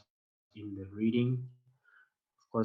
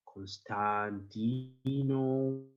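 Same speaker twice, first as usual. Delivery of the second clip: slow demo name; 2 semitones higher, much slower than usual. Shown as a demonstration. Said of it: "just try to read after me".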